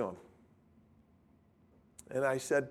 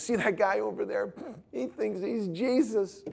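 mocking student; 6 semitones higher than usual. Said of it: "He goes".

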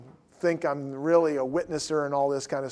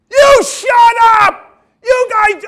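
furious full-volume shout, high and drawn out; losing it; 17 dB louder, slower than usual. Retold or imitated. CCD teacher losing it at two sophomores, furious high shout. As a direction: yell like a teacher who has snapped. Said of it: "Tighe lost it".

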